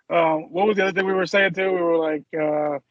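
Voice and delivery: normal talking voice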